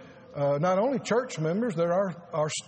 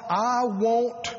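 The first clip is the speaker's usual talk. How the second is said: slow longing plea; 3 semitones higher, much slower than usual. Retold or imitated. Pleading for a word from God.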